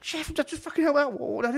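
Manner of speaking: high, loud begging; desperate plea. Retold or imitated. Cook begging the chef for help, desperate. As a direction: beg for help, at breaking point. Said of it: "I was like".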